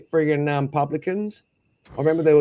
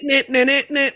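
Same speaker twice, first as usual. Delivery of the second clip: high, loud repeated no, no, no; loud protest; 10 semitones higher, 6 dB louder than usual. Real, not acted. Streamer protesting to chat that she didn't want it, loud. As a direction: cut them off with a rising chorus of no.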